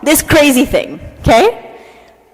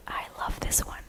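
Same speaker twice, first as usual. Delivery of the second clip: whispered, almost under the breath; hushed aside; 22 dB quieter than usual. Real, not acted. Speaker confiding to the audience about the next myth, hushed aside. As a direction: drop to a tiny whisper.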